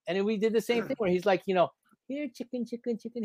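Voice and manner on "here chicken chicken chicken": soft, high coaxing call; imitated chicken call. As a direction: act out luring a chicken gently. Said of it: "He's calling it".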